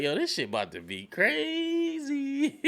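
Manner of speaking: drawn-out, high-pitched excitement; soaring, slow-building thrill; gleeful hype. Real, not acted. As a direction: stretch it out high, relishing the drama. Excited reaction to coming drama.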